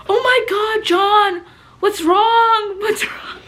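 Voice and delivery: high, loud fake worried gasp; hammed-up alarm over a yawning boy; mock concern. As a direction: fake a dramatic worried friend. Mock concern when boys yawn.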